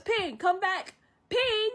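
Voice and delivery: high-pitched calling after someone; imitated yelling. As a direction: act out yelling someone back. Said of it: "Shang is still yelling at Mulan".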